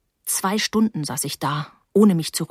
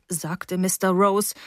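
ordinary delivery, nothing marked

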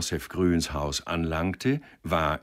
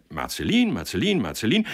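tallying votes; 5 semitones higher, 4 dB louder than usual. A narrator's reading. Rapid count of votes read out.

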